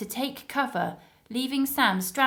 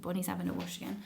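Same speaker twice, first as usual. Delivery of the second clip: ordinary delivery, nothing marked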